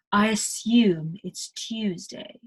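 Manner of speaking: slow British "assume" and "Tuesday", with y-glides; British pronunciation. Shown as a demonstration. Teacher repeating for learners, slow British pronunciation. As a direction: carefully say "assyume" and "Tyuesday" for assume and Tuesday. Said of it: "It's not "I assume it's Tuesday".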